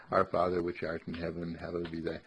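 rushed, mechanical recitation; rattled off prayer. Shown as a demonstration. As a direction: reel it off without meaning. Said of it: "You rattle 'em off".

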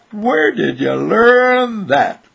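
loud, high, stretched-out amazement; amazed exclamation. Acted. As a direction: exclaim it slowly with mock astonishment. Onlookers react to silly trick.

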